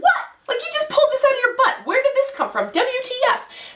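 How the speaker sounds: high-pitched shocked disbelief, retold; hammed-up, squeaky-high incredulity; incredulous outburst. Retold incredulous reaction to doctor.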